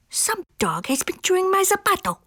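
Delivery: high-pitched little amigo voice; second little dog. A narrator's reading. Second amigo finds his shoe chewed, high character voice. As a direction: complain in a small high voice. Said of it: "said the second".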